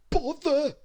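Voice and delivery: whispered mutter through a mouthful; mouth full. Acted. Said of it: "muttered Mister Greedy, with his mouth full".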